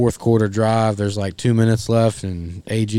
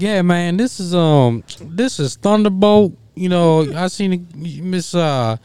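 high, louder mock caller voice; hammed-up prank caller voice; mimicking a caller; 10 semitones higher, 4 dB louder than usual. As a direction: play the smooth-talking prank caller, pitched up. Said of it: "he was like".

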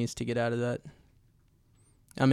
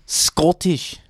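put-on Scottish accent, played for laughs; 2 semitones higher, 5 dB louder than usual. Shown as a demonstration. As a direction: show off a loud Scottish brogue. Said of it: "Scottish is easy 'cause you just gotta".

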